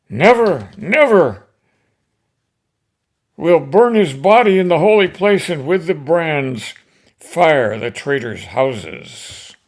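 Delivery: the mob roars for vengeance; furious crowd. Acted. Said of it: "the crowd goes crazy".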